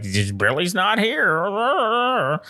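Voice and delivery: put-on high, slow quavering old lady. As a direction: drag out the words like a frail old woman.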